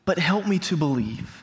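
halting, slow plea to God; earnest prayer. Retold or imitated. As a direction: pray it slowly, feeling for the words. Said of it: "Have you ever prayed something like that?".

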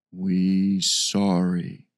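slow, low, halting ape-man. Acted. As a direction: speak slowly like a gentle beast.

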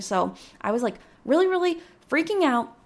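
ordinary talk, nothing marked

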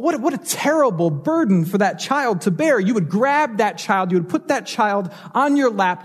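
usual speaking voice

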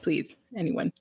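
small, hushed mock begging; quiet mock plea. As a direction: beg under your breath, in jest. Voicing someone desperate for any listener.

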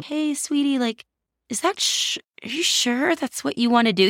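mock-gentle, coaxing the wife away from the pantry; sweet husband voice. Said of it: "in his most sweet tone of voice possible".

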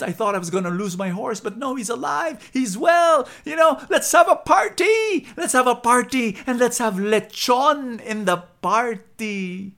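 high, loud, rushing joyful voice; overjoyed farmer. Acted. Farmer John calls all his friends to throw a party, loud joyful. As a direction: burst with relief, ready to celebrate.

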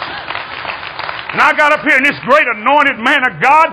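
high, loud pulpit proclamation; full-force preaching. Real, not acted. Preacher tells how God washed out the tournament, full-force preaching. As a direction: belt it out high to the whole congregation.

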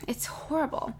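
hushed whisper. Real, not acted. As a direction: drop to a breathy whisper. Whispered aside.

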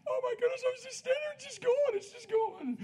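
high, teary voice of a woman overcome; tearful healed lady. Retold or imitated. Preacher imitating a tearful healed lady, high and weepy. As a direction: act out a lady crying as she tells her healing.

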